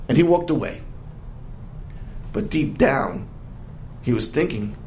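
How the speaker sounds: plain reading voice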